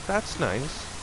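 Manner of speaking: slow, high, dripping sarcasm; a slow, high sneer of fake sympathy; sarcastic. Sarcastic reply to ex.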